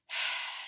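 audible breath at a full stop; demonstrated breath. Shown as a demonstration. Teacher showing pupils to breathe at a full stop, audible breath. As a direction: pause and take a clear breath. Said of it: "y respiran".

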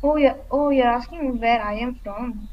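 ordinary delivery

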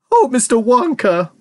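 high, drawn-out plea; wailed. A narrator's reading. Mother pleading with Wonka, wailing. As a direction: wail it slowly, imploring. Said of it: "wailed Missus T V".

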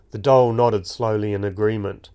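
plain reading voice

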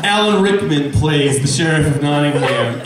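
ordinary talk

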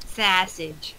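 "Sausage." in a mock Jersey accent. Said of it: "my Jersey accent".

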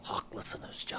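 voiceless conspiratorial whisper. Acted. Agreeing with Özcan in a plotting whisper.

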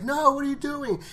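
high, panicked plea; panicked cry. Retold or imitated. Carol crying out as T-Dog charges the walkers, high panic. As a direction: scream it at him as he charges. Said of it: "she's like".

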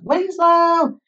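very high, sustained search call. A narrator's reading. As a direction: call down the street, holding the name. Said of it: "All along the street were calls of".

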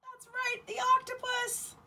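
high, delighted announcement; excited reveal. Acted. Teacher revealing the octopus to children, excited. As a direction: cheer the answer.

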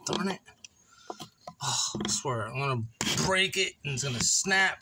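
ordinary delivery

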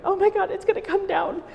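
very high, thin panicked voice; mortified kid. Retold or imitated. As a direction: imitate a terrified child in a high squeal. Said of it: "I was mortified".

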